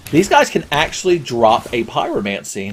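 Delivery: ordinary talk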